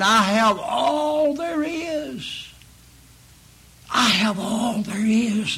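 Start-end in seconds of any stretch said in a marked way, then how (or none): 0.0-2.5 put-on old Simeon, slow and higher, savoring each word; old Simeon's words. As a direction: let the old priest rest content in it. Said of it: "And he said".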